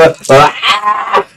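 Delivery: demonstrated high cry of frustration; venting scream. Shown as a demonstration. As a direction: show a short letting-off-steam yell. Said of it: "Sometimes you need to go".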